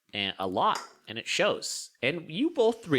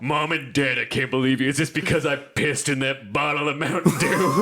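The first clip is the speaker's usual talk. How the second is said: hint of an indignant teen, louder; bratty teen; 6 dB louder than usual. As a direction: play the outraged kid confronting his parents. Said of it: "You're just like".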